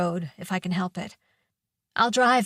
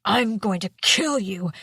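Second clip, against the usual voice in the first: growled. A narrator's reading. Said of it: "he growled".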